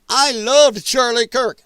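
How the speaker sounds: hammed-up mocking of a pundit; mock Candace quote. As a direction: parrot her claim with a sneer. Mocking Candace's claimed affection.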